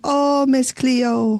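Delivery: slow, drawn-out Jamaican accent. Shown as a demonstration. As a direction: stretch out "Oh, Miss Cleo" in a Jamaican accent. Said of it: "That's all I can do of a Jamaican accent".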